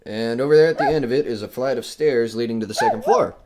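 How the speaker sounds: ordinary talk, nothing marked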